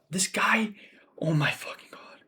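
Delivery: exasperated whispered aside; disgusted whisper. Real, not acted. Fan muttering about a fighter he hates, in a whisper. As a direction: whisper it in disbelief.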